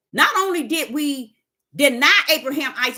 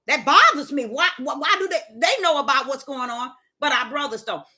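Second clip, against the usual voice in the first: normal talking voice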